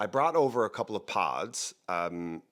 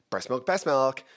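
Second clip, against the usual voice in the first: silly slogan; 5 semitones higher, 5 dB louder than usual. Pretend.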